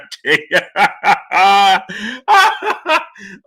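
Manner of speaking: high, loud burst of laughter. Real, not acted. Streamer laughing at a chat prediction.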